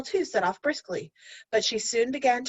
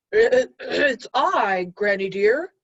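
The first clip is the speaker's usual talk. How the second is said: slow, sweetly put-on child voice; a wolf pretending to be a little girl; wolf faking a girl's voice; 5 dB louder, much slower than usual. Wolf at Granny's door, mock sweet little girl voice. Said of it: "making his voice as like Little Red R".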